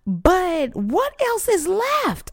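high, drawn-out anguished plea; retold crying out. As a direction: stretch the plea in a wailing high voice. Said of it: "crying and pouring my heart out".

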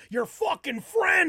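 high, slow furious yell; angry family shout. Retold or imitated. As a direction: blame him with a roar. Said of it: "they were like".

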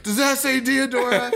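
high, exaggerated taunting question with a laugh; mocking question. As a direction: rub it in like talking to a child. Repeated taunt at driver.

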